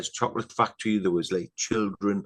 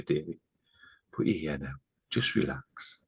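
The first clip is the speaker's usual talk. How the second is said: soft imitation of a comforting spirit; quietly acted soothing words; soothing hush; 5 semitones lower, 6 dB quieter than usual. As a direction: settle someone gently, voice falling away to near whisper. Vision woman soothes him, acted.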